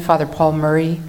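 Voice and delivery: normal talking voice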